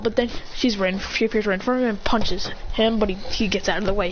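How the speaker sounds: usual speaking voice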